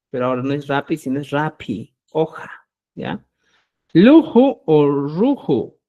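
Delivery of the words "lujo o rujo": Lujo o rujo said very slowly and loudly; slow contrast demo. Shown as a demonstration. Teacher contrasting wrong and right sounds, slow. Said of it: "no puedes decir".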